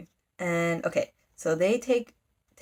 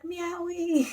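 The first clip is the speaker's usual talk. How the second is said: high-pitched, delighted pet-name cry; calling her cat; 11 semitones higher than usual. Retold or imitated. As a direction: squeal the cat's name up high. Power calling to her caged cat.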